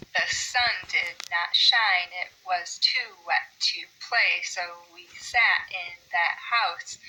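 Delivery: slow, stressing "sun", "not", "shine", "wet", "play"; metered reading. Shown as a demonstration. Teacher reads Cat in the Hat slowly, beats marked. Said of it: "you can hear a pattern here".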